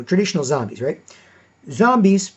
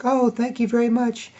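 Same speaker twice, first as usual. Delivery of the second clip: lightly voiced sweet thanks, a bit higher; sweet woman's voice; 7 semitones higher, 3 dB quieter than usual. Retelling the customer service woman's thanks, lightly sweet. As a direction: imitate her sweetened voice. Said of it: "her voice was all sweet and nice".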